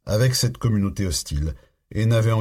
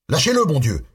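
higher, desperate shout; 7 semitones higher, 3 dB louder than usual. A narrator's reading. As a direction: Russell yells at the man holding a knife to Jimmy, desperate shout. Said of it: "cria Russell".

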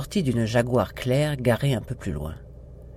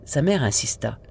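plain reading voice

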